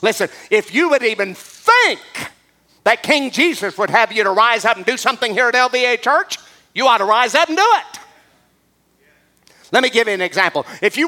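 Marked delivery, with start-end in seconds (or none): none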